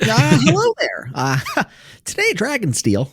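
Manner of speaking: playful high-pitched author voice; Brandon Sanderson impression. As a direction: mimic the author greeting fans. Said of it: "his impressions".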